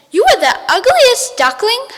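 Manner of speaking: high, loud mocking jeer; shrill witch taunt. Witch taunting the ugly ducks, high and loud.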